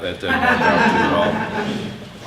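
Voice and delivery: chuckling through the words; rueful laugh while speaking. Real, not acted. Wry joke about drug mix.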